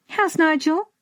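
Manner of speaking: light, high-pitched detached query; casually offhand question. A narrator's reading. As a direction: ask as if it hardly matters. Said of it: "her voice sounded to her father even more detached".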